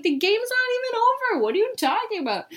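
high, fast tipsy squeal of confusion; drunk voice. Retold or imitated. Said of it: "my drunk voice".

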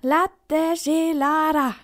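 very slow, loud, over-articulated Latte Gelara; boy repeating it slowly so the man understands. A narrator's reading. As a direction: spell it out for the foreigner, word by word. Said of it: "ganz langsam und laut".

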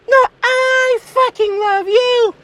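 very high, drawn-out gushing; out-love your partner in a slow high squeal; cartoonish swooning insistence; insistent girlfriend. Girlfriend outdoes his declaration.

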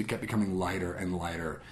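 ordinary talk

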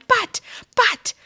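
high whispered buts; retold yell. Tony sputtering at Angela in a high whisper. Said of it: "he just starts yelling".

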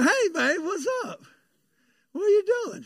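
easygoing, playful hello to your wife; casual old hippie. Retold or imitated. Sick husband greeting his wife from the bed, casual and easy. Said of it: "I don't talk pastoral and preacher-wise".

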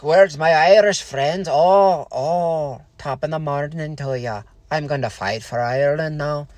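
a hammed-up stage Irish lilt, mocking; mock Irish accent. Mocking Irish-heritage pride, in a hammed-up Irish accent. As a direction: parody a cheery Irish-American, Irish accent. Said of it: "So I don't go like".

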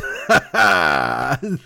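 drawn-out chuckling laugh. Real, not acted. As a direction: laugh to yourself before the bit. Laughs before taking a fake call.

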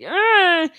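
high, loud imitated whine; distressed cry. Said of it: "she's like".

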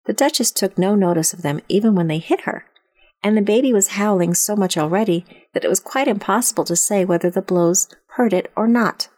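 ordinary delivery

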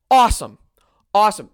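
loud, emphatic exclamation; cheering. Real, not acted. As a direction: call it out like a cheer. Cheering Red Wings comeback.

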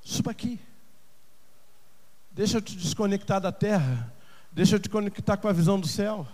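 soft, hushed voice of God; quiet, soothing appeal; tender invitation. Acted. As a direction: invite gently, almost confidingly. God gently inviting the listener.

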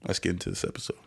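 usual speaking voice

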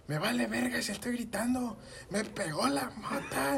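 very high mock yell, last words drawn out; grito imitado. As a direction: act out a freaked-out shout. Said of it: "Empiezo a gritar". (grito agudo y exagerado)

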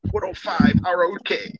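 high, loud station-ID imitation; radio station jingle. Listener imitating the radio things, station-ID voice. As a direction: call out the station ID in a put-on radio voice. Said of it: "I was just imitating the radio things".

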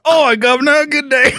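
cheery chimney sweep, hammed-up Cockney; mock Cockney. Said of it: "you're all Cockney".

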